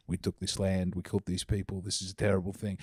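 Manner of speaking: hushed solemn announcement. Retold or imitated. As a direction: somber and low, mock reverent. Somber land acknowledgement parody.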